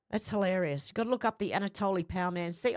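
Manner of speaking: ordinary delivery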